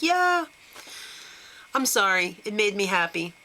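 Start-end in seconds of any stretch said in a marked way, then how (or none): 0.0-0.5 mock cheer. Fans celebrating Colton leaving, high mock cheer. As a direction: cheer like the villain just got voted out. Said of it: "were like".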